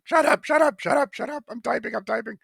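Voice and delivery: high-pitched, rapid-fire mock shriek; hammed-up frantic irritation, starting loud and easing off; frantic brush-off. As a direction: wave off an interruption in a squealed panic. Retold brushing off friend while typing.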